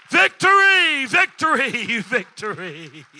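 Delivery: loud high cry fading down to a laugh; fading victory cry. Real, not acted. Pastor celebrating the answered prayer, victory cry fading into laughter. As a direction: shout it then let it melt into laughter.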